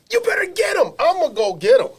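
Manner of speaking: high, loud mother cheering from the stands; yelling mother. Retold or imitated. Mother yelling at her son on defense, fierce and loud. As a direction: fire the kid up like a fierce mom.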